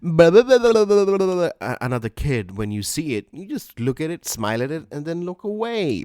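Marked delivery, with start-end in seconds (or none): 0.0-1.5 put-on high, loud babbling at a child; squealing blah blah at a stranger's kid; mock baby talk. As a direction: coo nonsense at someone's kid like a gushing stranger. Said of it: "You don't go and go".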